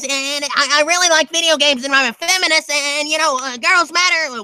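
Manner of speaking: high, squeaky nerd voice. Shown as a demonstration. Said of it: "there's the nerd ... kinda, like, squeaky, basic nerd".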